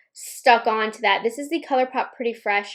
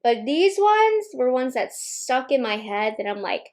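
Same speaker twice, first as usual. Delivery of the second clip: usual speaking voice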